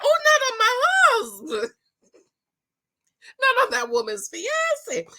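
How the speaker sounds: high mock-outraged protest; scandalized protest. Viewer scolding a contestant, high and mock-scandalized. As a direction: clutch your pearls at it. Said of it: "And I was like".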